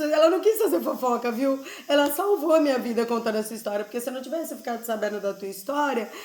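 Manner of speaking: ordinary delivery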